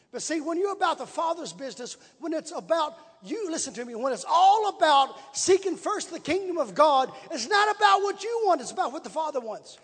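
rising into a loud, high sermon cry; full-force preaching. Acted. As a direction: preach it at the crowd, building. Preacher breaks into exhortation.

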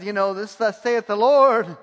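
imitating an insistent prophet; mock prophecy. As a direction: proclaim it like a self-appointed prophet. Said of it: "the guy prophesied and says".

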